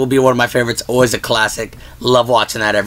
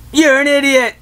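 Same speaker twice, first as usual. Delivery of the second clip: Grinch echo; 12 semitones higher than usual. Retold or imitated. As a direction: yell up high and trade lines with your own echo. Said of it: "He goes".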